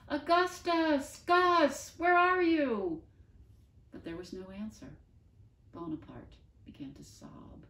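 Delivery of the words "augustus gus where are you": high, loud, slow shout; shouting loudly. Acted. Eddie calling into the smoke for the dog, a loud high shout. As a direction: shout as loud as you can, hands around your mouth. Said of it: "shouted as loudly as she could".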